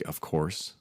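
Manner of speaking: ordinary talk, nothing marked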